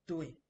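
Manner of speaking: Dui said short and firm with a falling tone; a crisp falling Dui; Mandarin tone. Shown as a demonstration. Teacher modelling the answer yes in Mandarin, firm falling tone. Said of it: "If you watch my tones".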